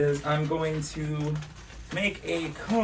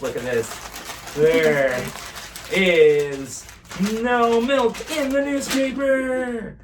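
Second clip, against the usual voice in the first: put-on kids party magician; 7 semitones higher, 6 dB louder, slower than usual. Magic trick reveal, bowing.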